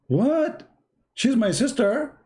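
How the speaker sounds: high, slow, disbelieving gasp of a question; he can't believe his ears; shocked son. Retold or imitated.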